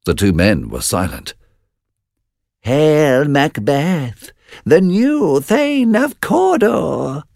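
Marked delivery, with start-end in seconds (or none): 2.6-7.2 very high, slow eerie witch voice; second witch crying out, high and drawn out. Acted. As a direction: sing out the prophecy like a witch. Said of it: "cried the second witch".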